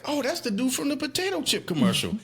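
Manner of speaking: high, excited realisation; suddenly recognising a face on TV; surprised recognition. Retold or imitated. Kid spotting the chip ad man on PBS, high and surprised. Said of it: "I was like".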